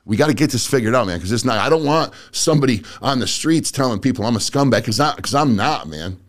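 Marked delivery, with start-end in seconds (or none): none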